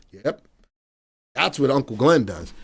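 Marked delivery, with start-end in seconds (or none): none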